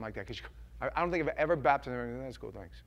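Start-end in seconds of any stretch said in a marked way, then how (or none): 2.2-2.8 soft thanks. Retold or imitated. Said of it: "baptized person thanking".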